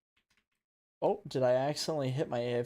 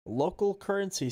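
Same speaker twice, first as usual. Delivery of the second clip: a hint of a robotic announcer; lightly voiced robot; 7 semitones higher, 3 dB louder than usual. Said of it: "says a robotic voice".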